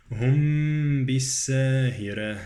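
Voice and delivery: slow "هم" hummed into "بالساهرة"; ikhfa of mim. Shown as a demonstration. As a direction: stretch the veiled m of "هم". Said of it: "إخفاء الميم".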